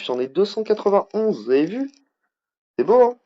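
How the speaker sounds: excited bragging. Real, not acted. Streamer proudly showing viewers his gold chests, excited. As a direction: show off your treasure, thrilled.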